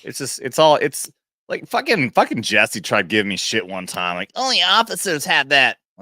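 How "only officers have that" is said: high, nasal-free mock sneer; mocking whine. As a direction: parrot him in a high sneer. Mocking Jesse's remark.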